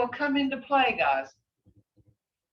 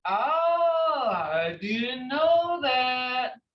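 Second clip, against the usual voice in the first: drawn-out surprised oh, very slow; mock surprise; 3 semitones higher, much slower than usual. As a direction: act a surprised student, stretch it out.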